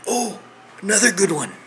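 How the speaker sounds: high, excited exclamation; sudden bright idea. Real, not acted. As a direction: burst in high with a fresh thought. Sudden idea after rambling.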